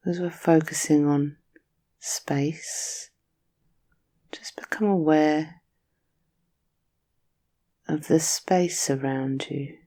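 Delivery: soothing Yoga Nidra guide; guided meditation. Acted.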